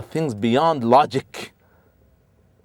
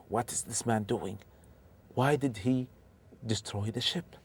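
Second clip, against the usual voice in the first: much softer, a hushed private thought; inner wondering; 13 dB quieter than usual. Acted. Musa silently questioning the strange man's deed, hushed. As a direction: puzzle it over to yourself quietly.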